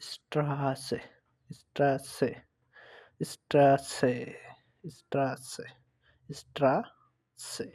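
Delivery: "স্ট্রাসে" with a long, stretched s; drawn-out Eszett. Shown as a demonstration. Teacher demonstrates Eszett to learners, lengthened "স্ট্রাসে". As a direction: stretch "স্ট্রাসে" out slowly, repeating it as a model. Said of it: "দীর্ঘভাবে একটু টানে পড়লে".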